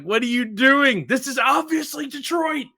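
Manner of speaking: high exasperated mock outburst; losing my mind. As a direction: retell losing it at a friend's wrong guess. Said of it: "I lose my mind".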